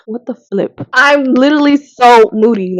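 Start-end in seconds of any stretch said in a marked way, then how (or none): 0.1-0.8 softer, grumbling what the flip; muttered curse. Retold or imitated. Vlogger retelling her moody reaction, in a muttered softer curse. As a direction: grumble it under your breath. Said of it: "over here like".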